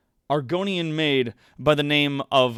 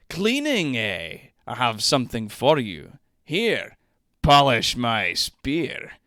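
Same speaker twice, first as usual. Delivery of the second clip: lusty lord voice; 5 dB louder, slower than usual. Acted. Streamer reading the lord's lines, slow and suggestive. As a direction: purr it like a sleazy nobleman. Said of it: "I'm playing both the characters".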